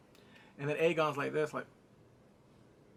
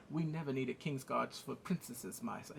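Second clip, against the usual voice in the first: lightly voiced measured reply, sinking at the end; defensive guard; 3 semitones lower, 6 dB quieter than usual. Answering character in acted bickering.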